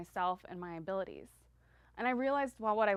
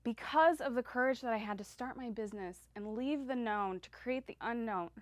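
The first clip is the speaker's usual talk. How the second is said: ordinary talk, nothing marked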